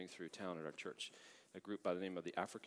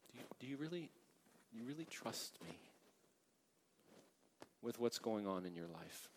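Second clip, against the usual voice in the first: softer, searching question; gentle asking; 7 dB quieter than usual. Acted. Lord quietly asking the congregation about trust, soft searching. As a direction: ask it quietly, heart to heart. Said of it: "the Lord just wants to say to us".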